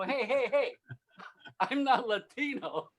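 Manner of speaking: high, laughing denial, slowing down; laughing protest. Retold or imitated. Mistaken for a gang recruit, laughing high protest. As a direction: laugh it off while insisting. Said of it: "And I go".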